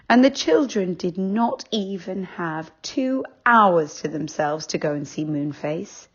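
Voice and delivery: ordinary delivery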